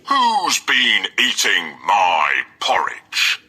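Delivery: slow, weighty papa bear voice; Father Bear. A narrator's reading. Father Bear finding his bowl, slow papa bear voice. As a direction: drag each word out like a big bear. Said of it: "Father Bear looked in his porridge bowl and said".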